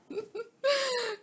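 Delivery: squealing laugh burst; a sudden high giggle. Real, not acted. Jokes about sacrifice.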